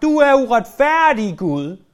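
shouted at God. Retold or imitated. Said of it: "havde råbt i Guds ansigt".

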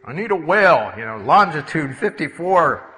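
God calling out orders to a creature; big booming dispatch call; loud summons. Acted. God summons a whale by coordinates.

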